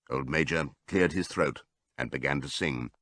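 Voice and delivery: ordinary delivery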